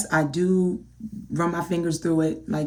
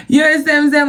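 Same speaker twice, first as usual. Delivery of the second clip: laughing; 7 semitones higher, 7 dB louder, much faster than usual. Real, not acted. Laughing at own panic story.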